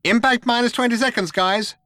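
high-pitched computer announcement; perky shipboard computer; chirpy computer. A narrator's reading. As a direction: chirp it to the crew, unbothered. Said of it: "said the computer".

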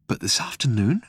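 whispered question. Acted. Barman asking Ford about the end, whisper. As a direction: breathe it in disbelief.

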